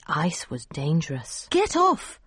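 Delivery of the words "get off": shouted. A narrator's reading. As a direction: shout the warning high. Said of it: "shouted Anina's mum".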